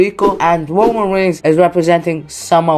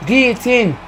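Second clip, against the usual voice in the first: higher, hyped ringside call; rising commentator excitement as the hold locks in; excited play-by-play; 5 semitones higher than usual. Acted. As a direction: call the action like a wrestling announcer. Live play-by-play of the match.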